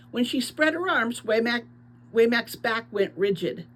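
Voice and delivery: ordinary delivery, nothing marked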